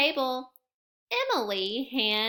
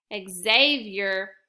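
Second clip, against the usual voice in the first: ordinary delivery, nothing marked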